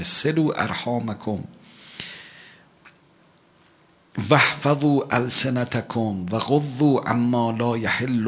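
slow, measured hadith chant; solemn recitation; Arabic recitation. Acted. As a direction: intone the Arabic slowly.

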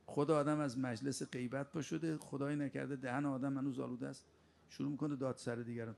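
ordinary talk

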